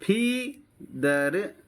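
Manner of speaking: Feather very slowly as फी, pause, दर; teacher modelling feather in two separate beats; syllable by syllable. Shown as a demonstration. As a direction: draw out each half of feather. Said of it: "आपको बोलना है ऐसे ही मेरी तरह".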